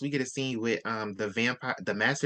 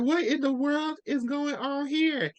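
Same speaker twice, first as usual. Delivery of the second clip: high, slow, drawn-out disbelief; hammed-up bewildered outcry, pitched way up; mock astonishment; 12 semitones higher, slower than usual. Acted astonished reaction in retelling.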